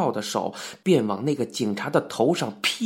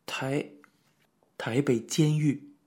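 falter, then let it out reluctantly; trembling confession; slower than usual. A narrator's reading. Wu Min confesses to the officer, trembling and halting.

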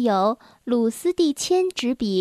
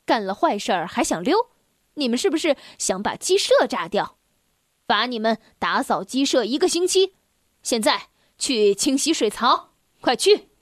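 scolding; 3 semitones lower than usual. Acted. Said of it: "继续教训".

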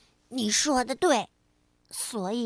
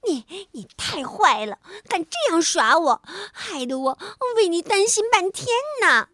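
fast, louder indignant scolding; angry; 5 semitones higher, 4 dB louder, faster than usual. A narrator's reading. Frog scolding the rabbit for tricking him, fast indignant scolding. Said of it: "跳跳十分生气".

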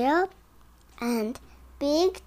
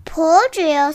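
urging; 4 semitones higher, 9 dB louder than usual. Acted. Urging in story.

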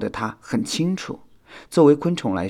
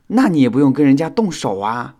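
higher, louder concerned scolding; caring reproach; 5 semitones higher, 8 dB louder than usual. A narrator's reading. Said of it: "小新关心地责问道". (关心地责问)

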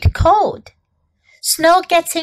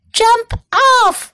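high-pitched urgent call, only slightly louder; storybook yell; 9 semitones higher, 3 dB louder than usual. A narrator's reading. John and Bob warn sledders of a snowman, mild yell. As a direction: call out a warning in a raised pitch.

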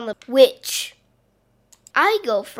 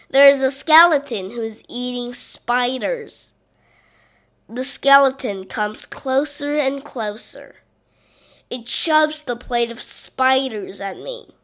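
usual speaking voice

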